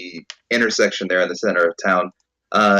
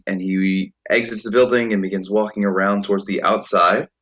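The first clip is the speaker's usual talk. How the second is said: usual speaking voice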